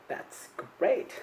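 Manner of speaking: ordinary talk, nothing marked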